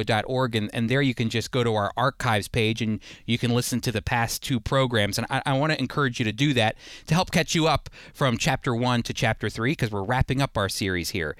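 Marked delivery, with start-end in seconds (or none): none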